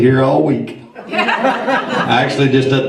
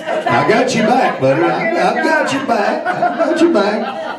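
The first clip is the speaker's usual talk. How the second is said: laughing; 7 semitones higher than usual. Real, not acted. Teasing Rick, laughing.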